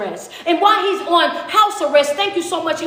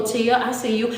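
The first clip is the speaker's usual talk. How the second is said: usual speaking voice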